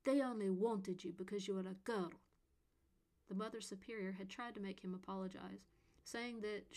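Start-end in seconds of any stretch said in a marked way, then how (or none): 0.0-2.1 put-on spiteful boy, louder, stress on They; mean and spiteful. A narrator's reading. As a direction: spit it out to hurt her. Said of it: "saying the meanest thing he could think of".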